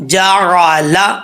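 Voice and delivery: جا را لا drawn out syllable by syllable; slow joined reading. Shown as a demonstration. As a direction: join the syllables slowly. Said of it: "تو کیسے پڑھیں گے؟".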